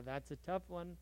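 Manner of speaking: usual speaking voice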